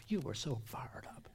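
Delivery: breathy hushed aside; whisper. Real, not acted. Preacher reminding believers of first excitement, whispered. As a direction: whisper it like a secret.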